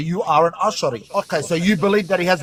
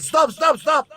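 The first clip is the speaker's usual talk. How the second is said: mock protest; 8 semitones higher, much slower than usual. Debater retelling a crude prophet story, mock protest. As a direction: pitch it up as a mocking imitation. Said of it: "and he said".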